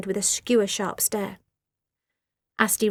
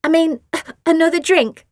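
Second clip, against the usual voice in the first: very high, loud fake-sweet offer; sickly sweet put-down; sweetly; 7 semitones higher, 8 dB louder than usual. A narrator's reading. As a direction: Asti faking sweetness at Phoebe. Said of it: "looking sweetly at Phoebe".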